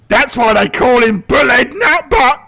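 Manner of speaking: high, loud cackling shaman voice; orc shaman. Acted. Shaman mocking the warlord to the player, high loud voice. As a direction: gloat like a mischievous goblin shaman. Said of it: "the shaman looks at you and he says".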